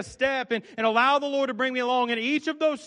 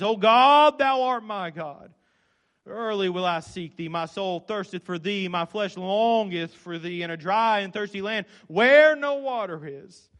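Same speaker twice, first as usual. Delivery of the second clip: slow, solemn psalm reading; measured King James recitation; scripture reading; 4 dB louder, slower than usual. Acted. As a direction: read the psalm reverently. Reads psalm aloud solemnly.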